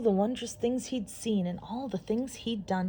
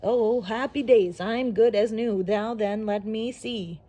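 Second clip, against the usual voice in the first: louder, joyful robot; happy robot; 5 dB louder than usual. Acted. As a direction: cheer like a mended robot. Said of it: "dancing merrily".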